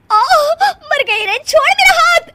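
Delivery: high, loud yelp of pain; cry of pain. Acted. Kirti yelps as Nisha twists her arm, high and loud. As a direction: shriek as your arm is twisted.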